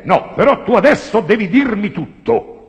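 high, loud pleading; insistent demand. Shown as a demonstration. Comedian demos the Italian sentence, high and insistent. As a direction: press them to tell all. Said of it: "questa è in italiano".

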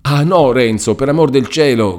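high, loud pleading cry. A narrator's reading. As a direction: beg him to stop, frightened. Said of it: "gridò Lucia".

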